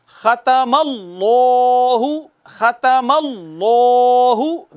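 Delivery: drawn-out tajweed recitation of ختم اللہ with heavy لام; slow recitation. Shown as a demonstration. Recites the corrected words.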